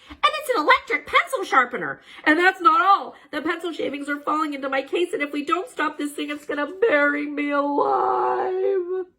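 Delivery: rising panic, ending in a cry; panicked. A narrator's reading. Gecko fears being buried by the shavings, panicked shout.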